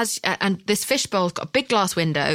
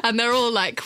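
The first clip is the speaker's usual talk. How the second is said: normal talking voice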